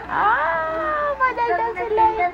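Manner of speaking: very high, drawn-out coo. Real, not acted. Mother to her chatty baby, high cooing.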